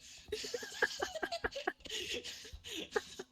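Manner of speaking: high squealing laugh. Real, not acted. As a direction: let out a high shrieking laugh. Laughing at teasing friend.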